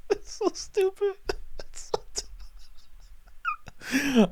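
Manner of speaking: high, strained words squeezed out through helpless laughter; can barely get the words out for laughing; laughing to tears. Real, not acted. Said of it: "I'm tearing up".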